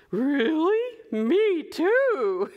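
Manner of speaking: high, amazed storybook voice; thrilled cow, pitched right up; delighted Cow. Acted. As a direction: answer with gleeful surprise. Cow delighted by Duck's habit.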